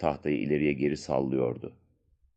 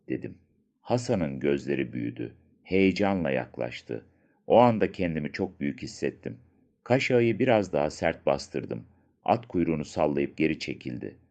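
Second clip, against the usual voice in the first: usual speaking voice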